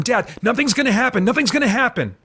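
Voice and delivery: half-acted panicked shout over his dad; mock shout. A narrator's reading. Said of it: "Tim is shouting over him".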